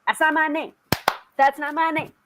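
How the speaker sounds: jokingly indignant, high complaint; mock angry. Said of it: "I get very angry when people misspell my name".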